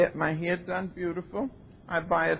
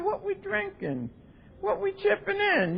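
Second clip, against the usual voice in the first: friends' line squeaked much higher; high mock friend; 12 semitones higher than usual. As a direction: pitch the drinking buddies' question way up. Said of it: "You said".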